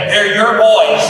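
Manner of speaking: mock exasperated wife raising voice; exasperated declaration. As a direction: pin it on him, loud and fed up. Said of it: "she would say".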